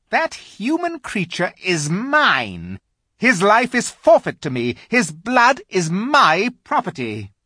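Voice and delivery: high, slow, cold witch. Acted. Witch claims Edmund.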